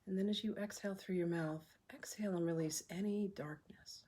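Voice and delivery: soft, unhurried meditation voice with long pauses; hushed, calm breathwork leader; guided meditation. Acted. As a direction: guide them gently through each breath, leaving room to breathe.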